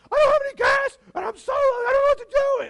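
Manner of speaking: frantic, high-pitched wail at top volume; panicked. Retold or imitated. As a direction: blurt it out loud and high in an exaggerated panic. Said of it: "I'm inviting her into my panic".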